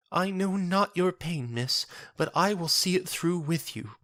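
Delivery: put-on a kindly young church sister soothing a stranger; gentle churchwoman. Marifold consoling Jenna in a high gentle voice.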